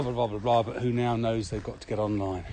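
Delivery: usual speaking voice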